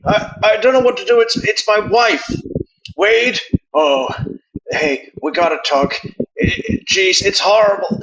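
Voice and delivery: high, loud, stammering panic act; upset Fargo guy. Acted. Host imitating the Fargo guy practicing his upset voice, hammed-up distress. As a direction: imitate a man rehearsing his upset phone voice. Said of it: "practicing his upset voice".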